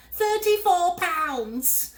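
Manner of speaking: very high, loud slow screech; bird shriek. A narrator's reading. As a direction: screech it as he vanishes. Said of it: "shrieked the bird".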